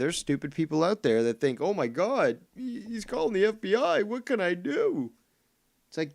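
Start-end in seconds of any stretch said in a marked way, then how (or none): 1.6-5.1 high, put-on panicky voice of a gullible fan; mock panic. Mocking gullible fans, high fake panic about the FBI. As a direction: squeal it like a scared dupe.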